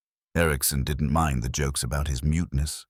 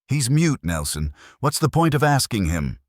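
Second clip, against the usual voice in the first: sharp, high and louder retort; snapping; 9 semitones higher, 5 dB louder than usual. A narrator's reading. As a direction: snap it at him, irritated. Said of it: "Sergeant Simmons snapped".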